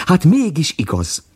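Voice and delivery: joyful. Acted. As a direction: sing out the joy. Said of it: "örült Vacska Mati".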